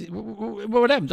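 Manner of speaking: high, stammering acted dismay; mock shock. Joke character shocked by bad news.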